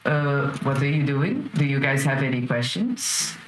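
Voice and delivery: low, politely puzzled servant; a lightly voiced worried butler. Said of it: "Alfred just looks at you guys and".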